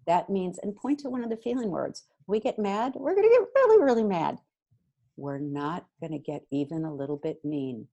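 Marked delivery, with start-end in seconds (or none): 2.3-4.4 big emphatic mad. Shown as a demonstration. Parent to kids, showing how mad we can get, loud and emphatic. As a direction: voice jumps high and loud on really.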